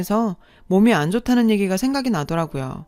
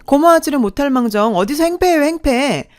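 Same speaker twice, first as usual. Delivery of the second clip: higher, louder sharp reproach; indignant scolding; 5 semitones higher, 4 dB louder than usual. Acted. Sister-in-law scolding the narrator in the shop, indignant and loud. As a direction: scold her for her rudeness.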